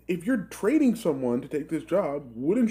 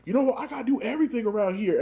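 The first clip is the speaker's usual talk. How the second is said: high, exasperated parent rant; freaking-out parent; 7 semitones higher, faster than usual. Retold or imitated. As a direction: play the fed-up parent melting down. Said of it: "They're like".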